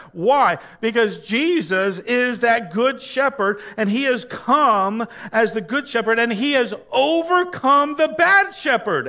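high, loud, fiery sermon climax; full-force preaching. Acted. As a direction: preach it out to the whole church.

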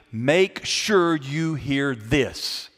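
each word drawn out slowly; slow emphatic meaning. Shown as a demonstration. Pastor to congregation, slow deliberate emphasis. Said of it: "It literally means".

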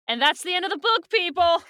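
high, loud wrap-up to the listeners; excited announcement. Real, not acted. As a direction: call it out loudly with a big finish. Announces the book is over.

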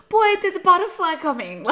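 jumpy passenger yelping a warning; mock panicked passenger. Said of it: "I'm like".

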